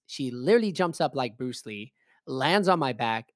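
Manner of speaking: ordinary delivery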